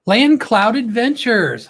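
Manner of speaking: drawn-out welcome call; announcer call-out. Acted. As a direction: announce the channel like a host. Host calls out viewer's channel name.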